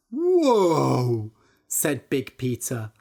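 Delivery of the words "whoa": loud amazed whoa; be bowled over. Acted. Big Peter amazed.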